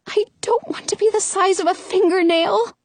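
small, high, voiced half-whisper; a touch of fearful whisper. A narrator's reading. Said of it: "she whispers".

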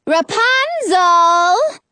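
long drawn-out, high, loud call; calling from outside. A narrator's reading. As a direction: holler up to a high window, stretching the name. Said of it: "a woman's voice from outside".

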